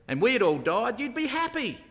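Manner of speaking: high, accusing retort; acted reproach, starting loud and climbing in pitch; forthright. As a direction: throw it at him as an accusation. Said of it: "he's pretty forthright".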